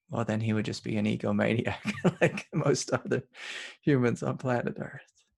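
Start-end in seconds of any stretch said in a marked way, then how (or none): 2.0-5.2 laughing through the words, pitched up; amused chuckling. Real, not acted. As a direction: let a real chuckle break into the phrase. Laughs at his own joke.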